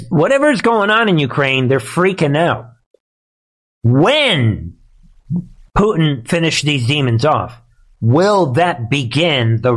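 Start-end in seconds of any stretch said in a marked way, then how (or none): none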